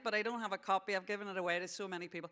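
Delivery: normal talking voice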